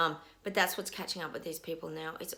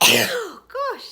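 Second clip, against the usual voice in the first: high, loud post-sneeze gasps; recovering from sneezes; after a sneeze; 14 dB louder, much slower than usual. Real, not acted. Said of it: "I'm gonna sneeze".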